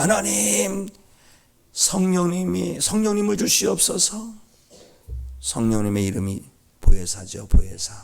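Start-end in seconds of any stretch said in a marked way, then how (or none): 0.0-4.3 high, drawn-out plea to God; beseeching prayer, pitched high; pleading prayer. Acted. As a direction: plead earnestly in a raised pitch. Acts out a prayer for the Spirit.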